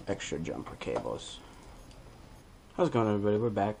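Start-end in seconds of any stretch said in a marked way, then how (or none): none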